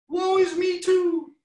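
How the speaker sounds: very high, slow squeaky lament; mock woeful peep. A narrator's reading. Smallest chicken joining the lament, squeaky woe. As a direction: peep a tiny melodramatic wail. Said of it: "peeped the smallest chicken".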